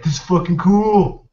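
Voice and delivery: slow, punched-up awe at something cool; excited kid reaction. Retold or imitated. Recalling own childhood thrill at sharp-toothed sharks, excited.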